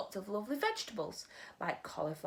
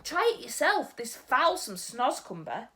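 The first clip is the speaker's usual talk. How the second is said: much louder, higher disgusted giant; 6 semitones higher, 9 dB louder than usual. Acted. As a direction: relish the awful taste loudly.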